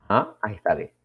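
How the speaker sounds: ordinary delivery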